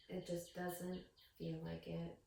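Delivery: soft, slow, a touch sad; understated sad. Acted. Girl telling mom softly she still feels hurt. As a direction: let it sink quietly. Sad child after crying.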